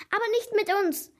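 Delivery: high, excited battle cry; shouting. A narrator's reading. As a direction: cry out to start the chase. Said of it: "schrie Noah".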